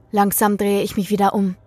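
usual speaking voice

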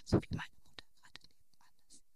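hushed whisper so mum can't hear. Real, not acted. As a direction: whisper it, she's next door. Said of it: "also ich muss nicht flüstern".

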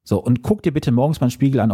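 ordinary talk, nothing marked

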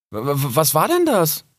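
taken aback, voice rising; startled stammer. Acted. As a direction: stumble on the first word in alarm. Fox startled by squirrel's snack.

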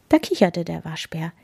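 ordinary delivery

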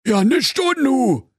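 very high, drawn-out retort; high Berlin brush-off; dismissive commissioner. A narrator's reading. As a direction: throw the question back, stretched out.

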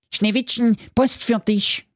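high, put-on dwarf voices calling out; sing-song call across the room; dwarfs calling in unison. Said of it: "riefen die sieben ... aus einem Munde".

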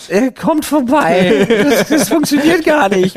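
high mock voice. Mocking the URL joke, high.

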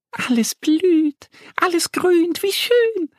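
high, delighted little duck voice; Annie calling out. Acted. Annie the duck delights in the spring flowers, high and joyful. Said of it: "ruft Annie".

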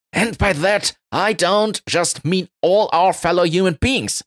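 high, impassioned elf speech; emotional speech. Acted. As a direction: preach it with feeling, high-pitched. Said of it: "giving an emotional sustainability speech".

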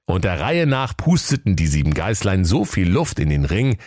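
plain reading voice

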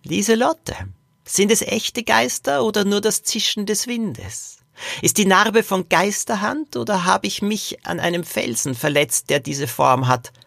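put-on high old man posing riddles; teasingly mysterious great-grandfather. Great-grandfather answers Lilo about the ghost cave, high mysterious voice. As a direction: pose the questions in a high great-grandfather voice, easing down.

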